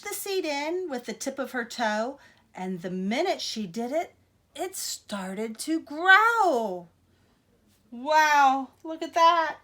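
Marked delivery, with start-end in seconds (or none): 4.6-6.9 drawn out and growing louder; slow, dramatic storybook build. A narrator's reading. Reading to kids, making the squash grow slowly and loudly. 7.9-9.6 loud wow. Real, not acted. Reader marvelling at the picture to young listeners, excited and loud. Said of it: "marvelling at the picture".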